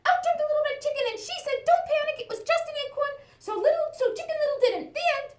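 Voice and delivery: high, fast, loud breathless chick; excited chick. Acted. Chick bursting into the fairy tale to warn Hansel and Gretel. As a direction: blurt it all out in a rush.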